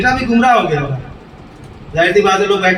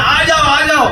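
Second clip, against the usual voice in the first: calling out; 7 semitones higher than usual. Retold or imitated. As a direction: call the son over. Father calling son from afar. (اونچی پکار)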